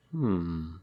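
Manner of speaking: ordinary delivery, nothing marked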